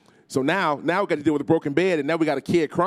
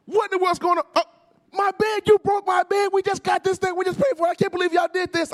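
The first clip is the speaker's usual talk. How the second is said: high, loud scolding shriek; angry mama; 4 dB louder than usual. Retold or imitated. Mother at three in the morning yelling at the boys, furious. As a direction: scold like a mother whose new bed is broken. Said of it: "she kicks the door open".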